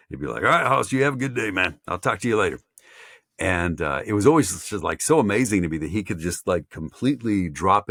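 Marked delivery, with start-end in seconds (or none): 0.4-2.6 put-on higher, folksy country accent. Radio man saying goodbye off mic, country accent. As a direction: sign off like a country buddy. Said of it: "his old country self".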